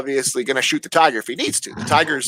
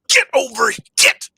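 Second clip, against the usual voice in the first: high, raised mock holler; imitated yell; 7 semitones higher, 3 dB louder than usual. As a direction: yell like an owner calling off a pet. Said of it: "Buddy comes out and yells".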